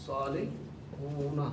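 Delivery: صالحون said slowly, its ending una drawn out; slow demo. Shown as a demonstration. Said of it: "اونا کی آواز ہے". (صالحون آہستہ، اونا کی آواز نمایاں)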